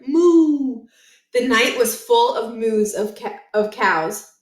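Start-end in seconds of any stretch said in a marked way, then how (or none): 0.1-0.9 moo. A narrator's reading. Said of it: "And another".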